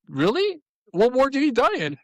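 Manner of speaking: mock amazement, eyebrows up; surprised disbelief. Teacher answering a ten-year-old girl, incredulous. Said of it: "I'm like".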